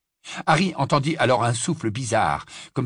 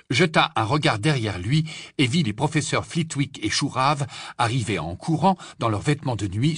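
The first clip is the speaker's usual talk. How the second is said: plain reading voice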